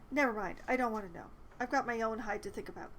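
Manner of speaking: normal talking voice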